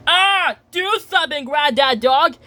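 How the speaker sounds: high, urgent acted plea; urgent appeal. Grandpa Pig stuck in his car asks Granddad Dog for help, urgent appeal.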